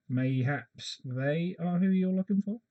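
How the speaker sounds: innkeeper's sly hint. Acted. Innkeeper nudging the traveller toward the grieving family, in a high, slow voice. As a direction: lean in and drawl the hint like a crafty barkeep.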